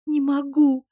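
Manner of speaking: high, loud choking wail; sobbing cry of despair. A narrator's reading. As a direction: wail through tears. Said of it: "захлёбываясь, провыла я".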